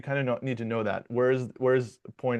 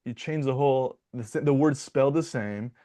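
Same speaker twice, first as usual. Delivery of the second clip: usual speaking voice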